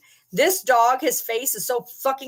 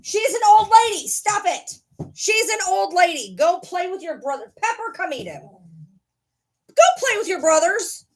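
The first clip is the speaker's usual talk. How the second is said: scolding the puppy; 9 semitones higher, 3 dB louder than usual. Real, not acted. Scolding puppy off old dog.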